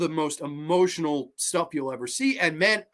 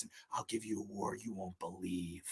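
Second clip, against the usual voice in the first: low, much softer gravel-free rumble of a menacing promise; Crenna's promo line; 8 semitones lower, 17 dB quieter than usual. Retold or imitated. Fan quoting the movie promo in a low, quiet menacing voice. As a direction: growl it low and quiet like a hype-man threat. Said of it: "I love the way he delivers that line".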